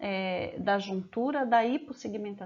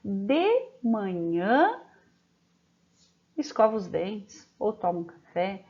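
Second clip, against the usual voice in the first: slow dictation. Shown as a demonstration. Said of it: "tem uma pausa".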